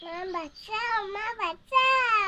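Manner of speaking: slow drawn-out loud cry for mum; calling for help. Acted. Daughter calling mum for help, slow loud wail. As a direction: wail for mum to come save you. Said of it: "এত চিৎকার করছিস কেন?".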